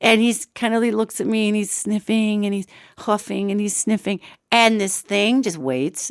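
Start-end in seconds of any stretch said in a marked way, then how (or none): none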